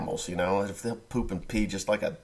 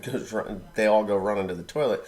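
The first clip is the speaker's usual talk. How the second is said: normal talking voice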